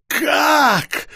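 high desperate cry; despairing wail. Acted. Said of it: "в отчаянии возопил вампир".

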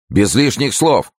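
high, slow, emphatic interruption; cutting in. A narrator's reading. Pelisson interrupting the wife, cutting in. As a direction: break in and stop the talk. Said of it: "перебил Пелесон".

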